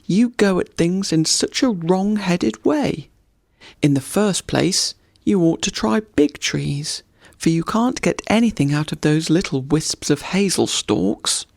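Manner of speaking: chirpy woodpecker giving advice; cheeky woodpecker. Acted. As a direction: lecture the young deer like a cocky bird. Woodpecker voice held.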